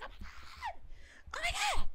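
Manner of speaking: hushed high squeal rising into a fuller squeal; mock fan scream. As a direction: gasp it very high, then squeal it as a starstruck fan. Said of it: "everyone was screaming for him".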